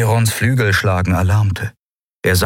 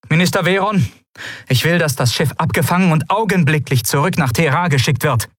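sharp command; 8 semitones higher than usual. A narrator's reading. Ruler gives a sharp order.

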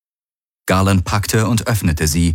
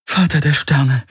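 breathless gasp, higher; 8 semitones higher than usual. A narrator's reading. Ki realizes she survived, gasping. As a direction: gasp it out in shock. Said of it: "keuchte sie".

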